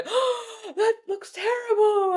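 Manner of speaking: high, wailing mock sympathy; shrill fake-sad sympathy; mock pity. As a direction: overdo the pitying gasp, up high. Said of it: "when you go".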